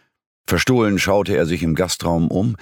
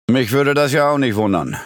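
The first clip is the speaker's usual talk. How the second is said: hearty, higher-pitched pub regular; strong voice; 8 semitones higher than usual. A narrator's reading. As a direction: chime in with a strong, raised voice. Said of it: "nicht weniger kräftiger Stimme". (mit kräftiger, angehobener Stimme)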